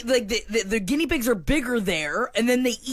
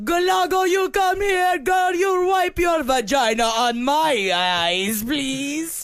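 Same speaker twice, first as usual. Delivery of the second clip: put-on Jamaican accent; much slower than usual. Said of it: "I love your accents ... Eastern European".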